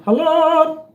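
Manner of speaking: loud high call of "Hello?"; loud, high "Hello?" into the mic. Real, not acted. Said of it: "mic test calls".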